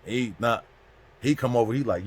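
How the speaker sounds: usual speaking voice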